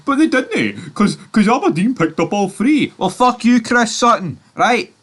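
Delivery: loud, high-pitched rant; angry outburst. Real, not acted. Vlogger telling Chris Sutton where to go, loud angry outburst. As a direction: let rip at the pundit, high and loud. Angry sign-off aimed at pundit.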